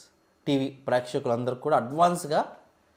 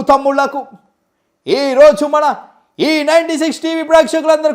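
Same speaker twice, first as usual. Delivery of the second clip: high, loud rally speech; politician impression; 8 semitones higher, 12 dB louder than usual. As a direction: greet the crowd like a leader on stage. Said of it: "politicians తీసుకొద్దాం".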